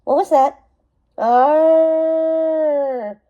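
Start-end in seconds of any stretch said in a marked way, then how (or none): 1.2-3.2 long, loud beast roar; monster roar. Acted. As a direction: roar like an angry ghost. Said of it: "It came again, loud".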